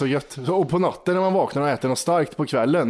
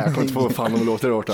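usual speaking voice

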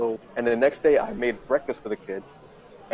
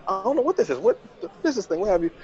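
high, flustered, tripping over the words; nervous flustered denial; 8 semitones higher, faster than usual. Retold or imitated. Caught by the boyfriend's son, high panicky denial. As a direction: play dumb while panicking inside. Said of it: "and I'm shaking".

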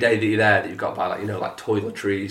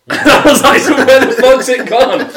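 high, loud, slow laughing outburst; laughing exasperation; 11 semitones higher, 9 dB louder, slower than usual. Retold or imitated. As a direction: let the disbelief burst out laughing. Exasperated retold question.

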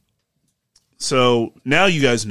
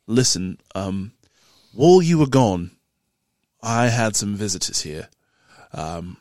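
serious tavern keeper; 2 semitones lower than usual. Acted. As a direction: recount a threat as a grave warning. Game master voices tavern keeper.

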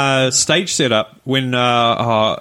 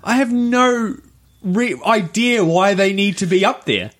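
pitched up high in mock disbelief; incredulous, raised voice; baffled; 7 semitones higher than usual. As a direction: act out how baffled you were watching. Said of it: "I was just like".

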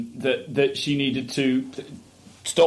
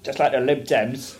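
bad Cockney accent. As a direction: have a poor go at Cockney. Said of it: "It's not my best accent".

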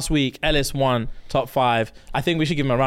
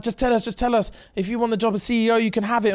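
eager Patreon staff; 8 semitones higher than usual. Retold or imitated. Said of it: "they were just like".

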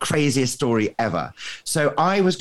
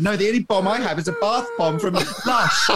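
higher, laughing through the punchline; 8 semitones higher than usual. Retold or imitated. Telling hosts his embassy answer, laughing. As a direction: giggle as you deliver the joke.

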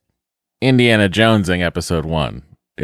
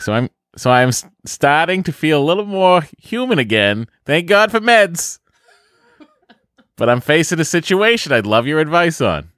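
higher, exaggerated Boston accent; 7 semitones higher than usual. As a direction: read it like a broad Bostonian. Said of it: "the accent from my, uh, our home".

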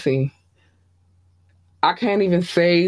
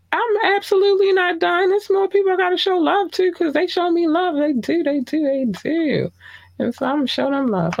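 much higher, playful excited voice; high excited insistence; 13 semitones higher than usual. Real, not acted. Host to listeners, high-pitched excited insistence. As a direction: squeal that you're not done yet. Insisting she's not finished with shoutouts.

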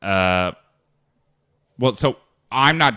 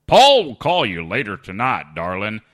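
put-on Texas accent; 2 semitones higher, 8 dB louder than usual. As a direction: lay on the Texas accent for fun. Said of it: "then followed by a Texas accent".

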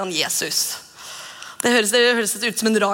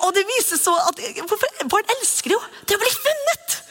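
delighted realization; 11 semitones higher than usual. Retold or imitated. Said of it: "Og jeg tenkte".

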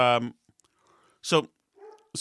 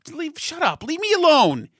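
shouted, much higher, fed up; exasperated snap; 12 semitones higher, 5 dB louder than usual. Retold or imitated. Father snapping at a scolding woman, fed-up shout. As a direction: bark it back at a nagging stranger. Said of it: "Like, Jesus".

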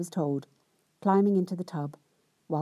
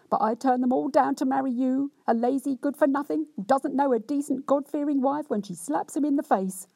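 hammed-up self-pitying rant; cross, wronged wife; 6 semitones higher than usual. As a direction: lay on the injured virtue, complaining. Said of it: "in much the same way as before".

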